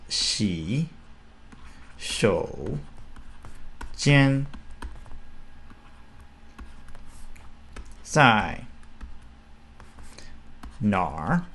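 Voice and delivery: slow Mandarin phrase with tones; Chinese tones. Shown as a demonstration. Teacher demos "Xi shou jian zai nar" for students, tone by tone. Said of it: "Which is".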